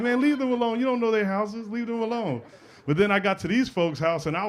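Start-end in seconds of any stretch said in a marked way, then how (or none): 0.0-2.4 high-pitched pleading defence; protesting defence. Retold or imitated. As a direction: protest in a raised pitch, fading off.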